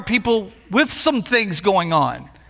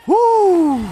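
mock pained woo; 7 semitones higher, 4 dB louder than usual. Pastor on bad marriage days.

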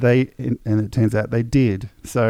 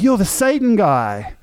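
excited fan; 10 semitones higher, 3 dB louder than usual. Retold or imitated. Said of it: "he's like".

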